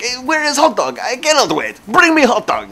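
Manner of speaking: high, mock-Russian impatient demand; mock Russian accent. Joker playing to the waiting line, mock Russian accent. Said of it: "saying things in that accent".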